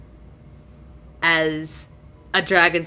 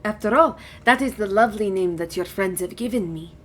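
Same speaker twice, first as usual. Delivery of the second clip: lightly voiced gracious dragon; 8 dB quieter than usual. Dragon introduces herself to Gadri, mildly gracious. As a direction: introduce yourself as a courteous dragon, understated. Dragon gives its adopted name.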